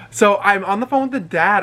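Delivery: ordinary talk, nothing marked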